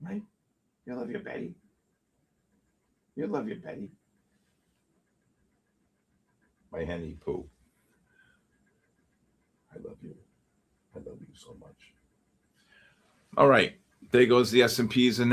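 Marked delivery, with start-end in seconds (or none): 0.0-11.9 soft, hushed cooing, partly whispered. Real, not acted. Trader turns from the charts to his pet Betty, soft whispered cooing. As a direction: murmur sweet nothings to a pet.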